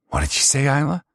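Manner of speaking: high, loud, fast mocking sneer; sneering abusive son. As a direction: taunt her like a cruel bully. Paul menacing.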